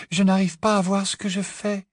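put-on high, gentle girl's voice; Luna's hushed complaint. A narrator's reading. Luna sawing at ropes in darkness, hushed complaint. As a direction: explain the trouble delicately, up high. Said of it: "chuchota Luna".

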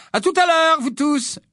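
a mum bursting with pride; mother beaming over her prefect son, voiced high; high gushing mother. A narrator's reading. Mrs Weasley voiced high.

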